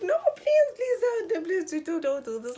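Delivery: high, loud frantic begging; panicked loud pleading to boyfriend; desperate pleading. Retold or imitated. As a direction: beg him not to leave, near tears. Begging boyfriend in retold breakup.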